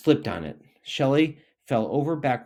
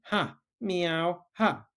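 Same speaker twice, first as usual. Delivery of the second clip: higher mocking ha with a meow; cat jeering at the tortoise; meowing laugh; 6 semitones higher, much slower than usual. A narrator's reading. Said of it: "meowed the cat".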